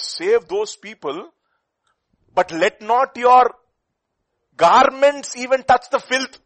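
slow, loud proclaiming of God's words; preacher thundering out God's command, slow and loud; forceful preaching. Acted.

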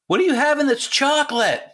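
high, excited call from upstairs; squeaky sister voice calling down the stairs; impersonating sister. Retold or imitated. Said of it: "your impersonation of her".

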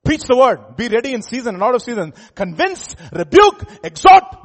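fiery loud preaching, high and loud. Acted. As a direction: thunder it from the pulpit. Preaching at full force.

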